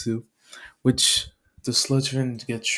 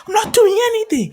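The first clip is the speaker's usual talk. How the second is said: fast, high, loud yelp; panicked protest; 6 dB louder, much faster than usual. Acted. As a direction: protest innocence while dodging blows. Hero protests while attacked.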